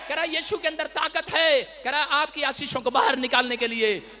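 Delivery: loud sermon voice; forceful preaching. Acted. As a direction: proclaim it to the congregation.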